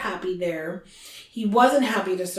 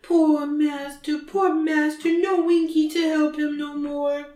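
mock tearful wail; 6 semitones higher than usual. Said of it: "she just starts crying again".

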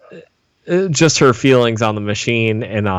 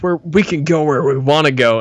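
mild mock yell, pitched up; a light, higher-pitched imitation of someone yelling; imitated yelling; 4 semitones higher than usual. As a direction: retell his yell at half strength, voice raised in pitch. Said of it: "I love as he's yelling that".

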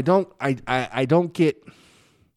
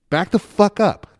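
high, loud burst of annoyance; worked-up demand to back off; exasperated warning; 9 semitones higher, 6 dB louder than usual. Retold or imitated. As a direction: snap it at someone crowding you. Acts out warning strangers off.

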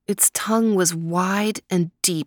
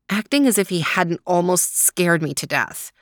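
ordinary delivery

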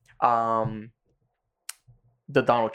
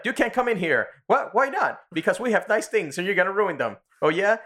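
high-pitched imitation of squabbling; mock quarrel; 10 semitones higher than usual. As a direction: act out a petulant, high-voiced argument. Acted quarrel at the wall.

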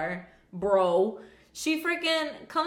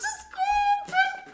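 high mock urging; much slower than usual. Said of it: "And I was like".

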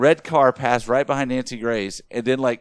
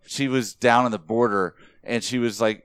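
normal talking voice

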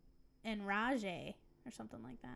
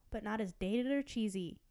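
ordinary talk, nothing marked

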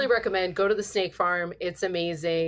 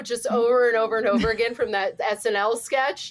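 normal talking voice

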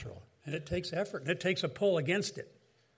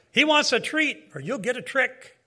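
mildly menacing, much higher-pitched demand; mock threatening; 7 semitones higher, 4 dB louder than usual. Costumed trick-or-treater at the door, pretending to threaten. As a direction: play at being evil, pitched well up. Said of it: "pretending to be evil and threatening".

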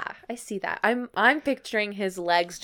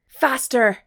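raised, higher narrated shout; 5 semitones higher, 7 dB louder than usual. A narrator's reading. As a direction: call it out as an urgent command. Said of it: "I shout to my unicorn lover".

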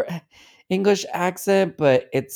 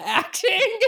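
high squeaky laugh through the words. Real, not acted. Mocking Gal Gadot's acting to friends, laughing high. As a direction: crack up mid-word.

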